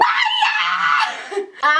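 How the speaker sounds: shrieking laugh far above her voice; real overjoyed squeal of laughter; high squealing laugh. Real, not acted. Hearing her question read out.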